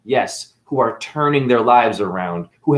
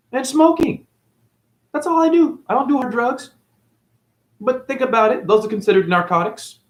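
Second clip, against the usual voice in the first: much higher, breezy offhand list; mock casual; 11 semitones higher than usual. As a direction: toss it off lightly, high. Mock-casual listing habits.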